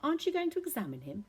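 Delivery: high, rising, astonished question; surprised. A narrator's reading. Girl to doctor who won't examine brother, shocked. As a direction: voice jumps up in disbelief. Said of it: "I stared at him in surprise".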